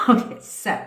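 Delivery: normal talking voice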